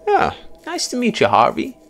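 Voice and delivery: high, loud, delighted American man; animated American with big swoops in pitch; jovial American. A narrator's reading. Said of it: "A jovial American voice".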